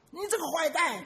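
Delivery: put-on child's yell. Child yells insult at echo.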